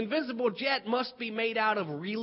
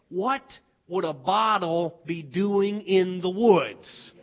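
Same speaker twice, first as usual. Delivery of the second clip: slow, lower retort with a pause after "What"; mock challenge; 4 semitones lower, 3 dB louder, slower than usual. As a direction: spell out the question in a child's argument. Children's acted argument, Skeeter challenges.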